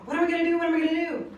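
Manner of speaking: high-pitched panicked retelling of brother; anxious, rising panic, imitated; freaking out. Brother panicking over child support papers, freaked-out voice. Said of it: "he was freaking out".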